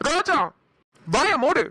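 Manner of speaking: high-pitched, angry snap; yelling. Acted. Telling Roja to shut up, yelling. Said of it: "ஏன் கத்துறீங்க?".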